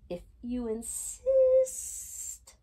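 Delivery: drawn-out, slow, high snake voice. A narrator's reading. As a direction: stretch every word like a lazy snake. Boa constrictor answers slowly.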